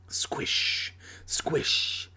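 whispered sound effect with long "sh"; soft, unvoiced squelch; squishing noise. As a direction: hush it like slime sliding. Monster's squishing noise in story.

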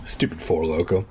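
ordinary talk, nothing marked

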